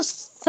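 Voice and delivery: स as th in "think"; drawn-out lisped स, tongue slightly out; tongue-tip lisped स. Shown as a demonstration. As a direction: say स with the tongue tip against the upper teeth. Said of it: "इसे हमें स कैसा पढ़ना है".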